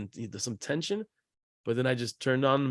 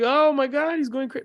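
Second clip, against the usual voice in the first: imitating alarmed daughter; 12 semitones higher, 4 dB louder than usual. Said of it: "she's like".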